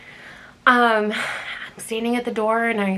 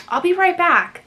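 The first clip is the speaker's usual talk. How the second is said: nervous exit; 9 semitones higher, 6 dB louder than usual. Retold or imitated. Scared, backing out of house.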